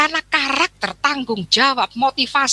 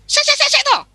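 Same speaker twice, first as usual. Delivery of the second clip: much higher, sharp mock brush-off; a clerk shooing off a student mid-typing; aggressive brush-off; 3 dB louder than usual. Office clerk snapping at a student, aggressive and high. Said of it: "Wah, itu agresif".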